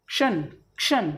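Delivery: "क्शन" said with half k then sh, twice; ksh sound. Shown as a demonstration. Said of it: "आधा क और फिर श". ("क्शन" आधा क और श के साथ)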